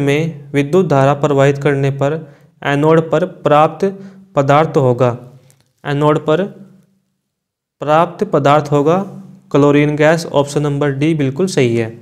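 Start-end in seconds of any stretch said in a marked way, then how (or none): none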